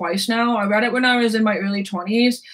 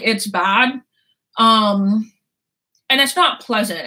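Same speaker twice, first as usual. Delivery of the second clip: normal talking voice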